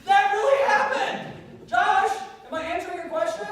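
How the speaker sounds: impassioned high-pitched exhortation; full-force preaching. Real, not acted. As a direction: shout it to rouse the whole crowd. Climax shouted to the crowd.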